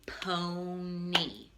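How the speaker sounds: "pony" said slowly as PO-nee, long o then long e; "pony" stretched for children; word modeled clearly. Shown as a demonstration. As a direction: sound out "pony" with the long E at the end. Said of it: "Like this word".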